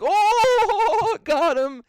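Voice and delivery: high, loud, gloating mock laugh; gleeful mock. Mocking his friend gloating over the prank, high loud cackle.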